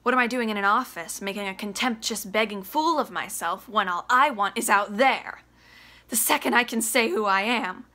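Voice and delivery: loud, raw, high shouting; furious son. Acted. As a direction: tear into his father. Biff rages at Willie.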